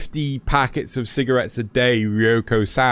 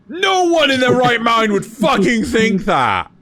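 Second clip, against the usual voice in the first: higher, louder outburst; exasperated shout; 8 semitones higher, 4 dB louder than usual. Real, not acted. Griping to friends about a silly anime trope, shouting. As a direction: shout it in disbelief. Ranting about Death Note logic.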